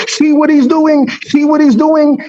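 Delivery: high, indignant mock protest; offended. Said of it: "get offended and say".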